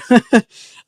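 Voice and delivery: sudden loud burst of laughter. Real, not acted. Laughing out loud at a teammate's odd spelling. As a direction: crack up loudly at the spelling.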